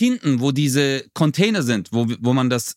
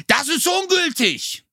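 high, drawn-out imitation of a screaming clerk; retold shriek, slow and high without real volume; mock scream; 12 semitones higher, slower than usual. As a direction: act out his sudden outburst, pitched way up. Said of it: "schreit er auf einmal so".

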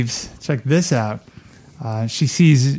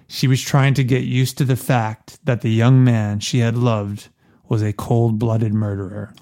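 ordinary delivery

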